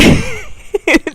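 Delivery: high laugh breaking into the sentence; real laugh. Real, not acted. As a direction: crack up mid-word. Laughs at her own theory.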